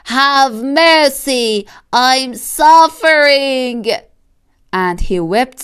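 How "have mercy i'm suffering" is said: slow, high, drawn-out begging; pleading fox. Acted. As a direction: stretch every word out in pain.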